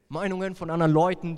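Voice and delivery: usual speaking voice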